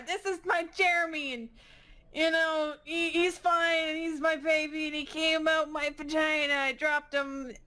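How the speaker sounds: high-pitched, insistent bluff; bluffing mother. Acted.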